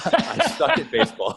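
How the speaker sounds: high laughing mimicry. Real, not acted. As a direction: repeat it through laughter. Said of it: "laugh on line".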